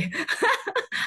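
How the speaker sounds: a quick high laugh, amused; short laugh. Real, not acted. Laughing while telling of her aunt by the road. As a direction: laugh it off lightly.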